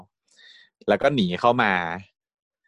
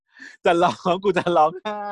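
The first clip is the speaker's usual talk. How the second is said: put-on slow, higher whining like a sulky kid; whiny sulk; 7 semitones higher, slower than usual. Kan sulking in Tin's arms, slow higher whine. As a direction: stretch it out, pouting about to cry. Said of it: "โวยวาย งอแงตามภาษาอีแขน".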